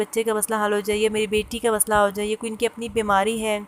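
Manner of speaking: normal talking voice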